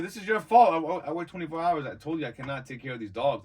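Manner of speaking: loud, high-pitched protest; retold angry blaming. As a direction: lay into the parents, re-enacting the outburst. Retold blaming parents for lost dog.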